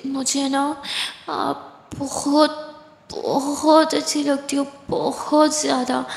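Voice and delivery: slow, dragged-out tipsy confession; drunk. Acted. Tipsy Aditi confesses she likes Kiran, slow and drawn out. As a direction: slur it slowly like she's had too much. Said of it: "Aditi उधर ही टुन हो जाती है".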